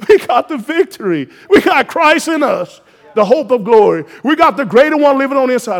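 loud, high preacher's shout; rising to a roar over the congregation; full-force preaching. Acted. Preacher to congregation, calling angels in a full-force shout. Preacher declares the church's power.

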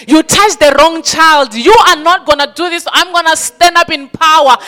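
loud declaring preacher; fiery preaching. Acted. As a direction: preach it with force. Preacher declaring defiance at full force.

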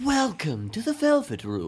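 lightly voiced high old man greeting; Igor voice. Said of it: "higher old man voice".

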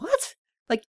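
high, incredulous "what?"; baffled surprise. Retold or imitated. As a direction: act out a squeaky-high double take. Mimes baffled reaction to pronunciation.

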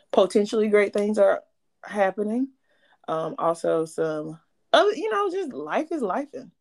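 ordinary delivery

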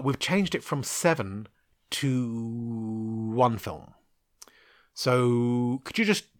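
slow, drawn-out mock studio voice; reluctant news-breaking. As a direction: stretch the words out, stalling over bad news.

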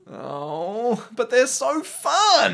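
high, slow whiny protest; mock whine. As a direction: whine it like a guilty pleasure. Mock whining about sins.

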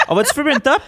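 high, loud and laughing; pumped to go smoke; excited. Retold or imitated. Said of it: "Mais juste avant, c'est genre".

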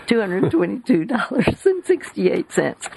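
talking through laughter; real laughing. Real, not acted. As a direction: try to read on while laughing. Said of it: "I'm laughing".

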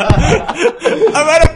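high, loud, drawn-out laughing; laughing in disbelief. Real, not acted. Host cracking up over his mate's nap excuse, laughing in disbelief. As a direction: crack up while getting the words out.

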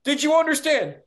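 high, loud parody of a pushy caller; badgering caller. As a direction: nag him over and over in a silly high voice. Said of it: "badgering me".

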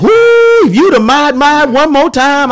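high, loud hyped-up cheering; mock hype from the church crowd; excited praise. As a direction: imitate the crowd hyping him up. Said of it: "everybody come to me talking about".

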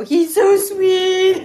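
gushing. Real, not acted. Mother gushing over her baby.

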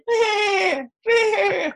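high bleating cry like a tethered goat; playing the tethered goat, high mock bleat. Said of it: "And I went".

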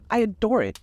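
high, delighted exclamation; exclaiming. A narrator's reading. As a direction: gush with delight as Daisy. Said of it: "exclaimed Daisy".

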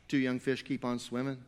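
ordinary talk

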